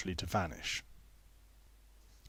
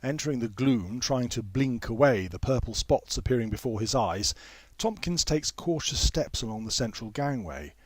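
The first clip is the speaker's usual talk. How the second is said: plain reading voice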